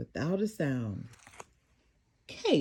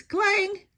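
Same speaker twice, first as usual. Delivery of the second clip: clang; 12 semitones higher, 4 dB louder than usual. Acted. Noise effect.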